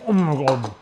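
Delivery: choked gasp from hot food; throat burning. Real, not acted. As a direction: burnt mouth gasp. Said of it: "my throat's burning".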